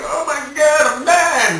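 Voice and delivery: high panicked shout at the bleeding; panicked yell. Retold or imitated. Freaking out over a bleeding cuticle, panicked high shout. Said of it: "I was like".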